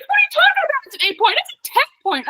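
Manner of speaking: high, excited disbelief; bursting with excitement at the size of the buck; excited friend. Retold or imitated. The friend telling her the buck is a ten point, high and excited.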